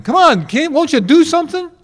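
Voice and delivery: high, loud cry for help from the boat; panicked disciples shouting at Jesus; frantic yell over the storm; yelling plea. Retold or imitated. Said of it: "so they yell at him".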